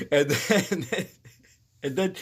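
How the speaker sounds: words broken up by laughter; laughing. Real, not acted. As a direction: try to continue the story through laughs. Laughing while telling the story.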